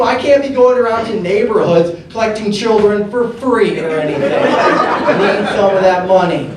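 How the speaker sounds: normal talking voice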